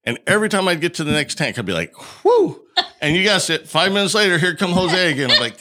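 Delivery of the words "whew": high, loud whoop of relief; relieved whew. Retold or imitated. Arrested man reaching the next holding tank, high relieved whew.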